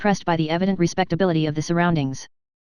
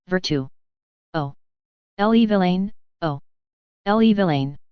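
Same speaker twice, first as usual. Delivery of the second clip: scolding the dog. A narrator's reading. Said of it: "she cried, with a slap".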